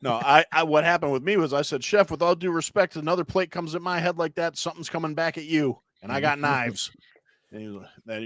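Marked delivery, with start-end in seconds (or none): 1.8-6.9 raised-pitch retelling of standing up to the boss; mock firm warning. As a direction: warn the chef, pointedly, as a retold comeback. Young cook warns the yelling chef.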